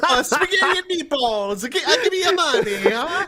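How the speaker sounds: high laughing mock voice; mock Italian Ralph. Guest parodies a streamer, high laughing mock voice. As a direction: pile on silly Italian words. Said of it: "Is there any joke there?".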